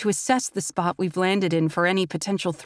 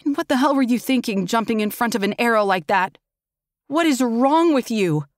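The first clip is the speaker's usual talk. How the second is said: raised, fast scolding; angry outburst; 4 semitones higher, 3 dB louder than usual. Acted. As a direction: chew him out for the reckless stunt.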